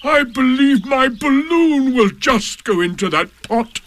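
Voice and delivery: suddenly thrilled with an idea; excited donkey. A narrator's reading. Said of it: "he became quite excited".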